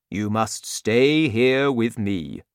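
a lightly voiced big giant; friendly giant. A narrator's reading. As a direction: explain like a big worried giant. Giant speaks.

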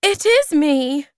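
high, loud desperate insistence; crying out. A narrator's reading. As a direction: cry it out, pleading to be believed. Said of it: "he cried".

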